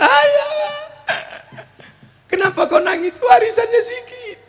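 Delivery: high crying shout, hammed-up; fake-sob like a greedy heir; mock wailing heir. Greedy child mourning the small inheritance, hammed-up wail.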